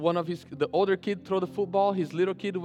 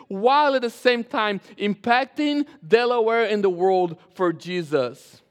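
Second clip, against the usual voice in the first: raised, loud pulpit voice driving each phrase home; forceful preaching; 4 semitones higher, 5 dB louder than usual. Acted. Pastor casts the church's vision to congregation, forceful preaching. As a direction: preach the list with building conviction.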